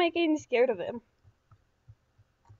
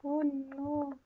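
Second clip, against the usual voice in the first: ordinary talk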